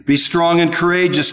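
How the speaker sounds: Be strong and courageous called out high and loud; ringing declaration; proclaimed. Acted. God's words first quoted aloud.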